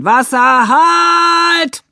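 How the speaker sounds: loud, high, long-held command; firefighters' shout. A narrator's reading. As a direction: holler it out and hold the last word. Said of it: "Hat die Feuerwehr geschrien". (laut und langgezogen geschrien)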